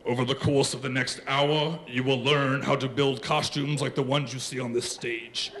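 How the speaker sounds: low, monotone lecturing villain; deep, solemn masked-villain voice; Darth Vader. Acted. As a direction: intone the announcement like a dark lord. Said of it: "Darth Vader steps up to the microphone".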